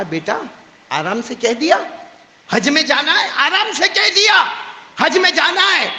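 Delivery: mother snapping at her son; angry mother. Retold or imitated. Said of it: "ماں کا دماغ گرم تھا".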